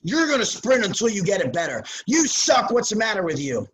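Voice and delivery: hammed-up angry coach yelling at players. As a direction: bark the ultimatum like an old-school coach. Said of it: "than they will".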